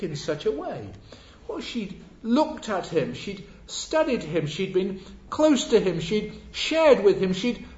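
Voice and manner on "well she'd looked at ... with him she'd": slow, high, emphatic sermon list; impassioned, drawn-out pulpit crescendo; preaching. Real, not acted. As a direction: build phrase by phrase to a loud climax.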